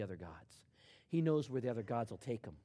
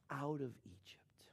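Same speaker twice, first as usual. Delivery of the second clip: whisper; 8 dB quieter, slower than usual. Acted. Quoting God, voice drops.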